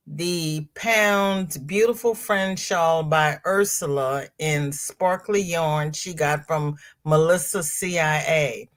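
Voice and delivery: ordinary delivery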